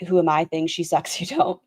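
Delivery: normal talking voice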